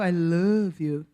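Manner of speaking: slow, low American-accented English; foreign accent. Shown as a demonstration. Demonstrating the foreigner's version, American accent. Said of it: "O sotaque estrangeiro é".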